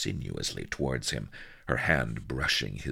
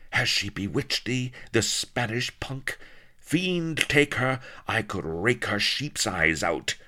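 much higher, louder jealous tirade; raging; 8 semitones higher, 4 dB louder than usual. Acted. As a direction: rage at the rival in jealousy. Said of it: "raged to and forth".

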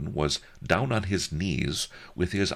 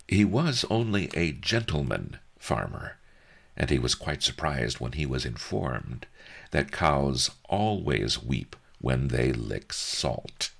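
plain reading voice

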